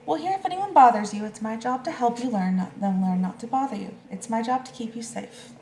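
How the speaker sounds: caring grown-up calling out to the little animals; protective grown-up. Acted. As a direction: step in firmly to keep them safe. Big protector reassures little animals.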